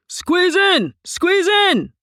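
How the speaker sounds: loud, high insistent call; urging conductor. A narrator's reading. Conductor urging passengers to squeeze into the car. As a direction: push the crowd in with your voice. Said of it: "the conductor urged".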